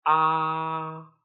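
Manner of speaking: "ah" held long on a level, flat tone; first tone. Shown as a demonstration. Teacher demonstrates first tone, long flat "ah". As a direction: sustain "ah" without moving the pitch. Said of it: "also called the flat tone".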